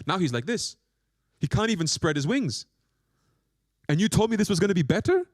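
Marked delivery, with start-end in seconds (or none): 3.9-5.3 indignant complaint. Retold or imitated. Bird accusing the guru.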